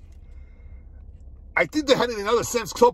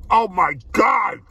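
slow, loud, amazed shout; drawn-out amazed outburst; excited shout; 4 dB louder, slower than usual. Real, not acted. Reacting to the first bite.

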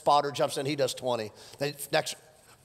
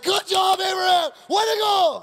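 high, loud hammed-up praise; loud gym cheer; cheering shout; 8 dB louder than usual. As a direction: cheer him on like a proud spotter. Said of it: "He's like".

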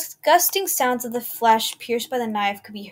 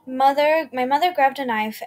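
ordinary delivery

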